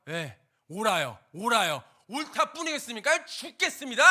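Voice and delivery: loud, high, building to a shout; furious outburst. Retold or imitated. Jonah shouting back at God in anger, building loud. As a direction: explode with pent-up anger.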